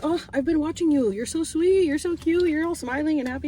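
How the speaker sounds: high-pitched, sweet-talking praise; retold gushing compliments. Customer complimenting the cute restaurant man, high gushing re-enactment. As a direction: re-enact cooing over someone adorable. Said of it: "I was like".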